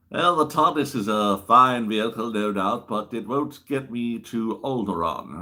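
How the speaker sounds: an impression of the old Jedi, drawn out at the end; Obi-Wan. Obi-Wan doubts the tardis can reach Alderaan, in a put-on Jedi voice. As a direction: play a measured Jedi politely doubting the ride. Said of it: "The second Doctor met Obi-Wan".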